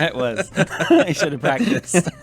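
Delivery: laughing through the words; giggling while admitting it. Real, not acted. Laughing at the elf voice.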